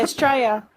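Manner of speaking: "Australia" said as Straya; shortened Aussie form. Shown as a demonstration. Aussie confirms her own word, "Australia" shortened to Straya. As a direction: drop the start and the L of "Australia". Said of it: "Beck just said Australia".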